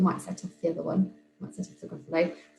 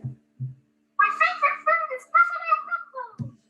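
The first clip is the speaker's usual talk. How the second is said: high squeaky mouse voice on a playback; recorded squeaky mouse line coming out of the laptop; mouse voice played back. Teacher plays back her recording, tinny squeaky mouse voice. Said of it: "squeaky little mouse voice".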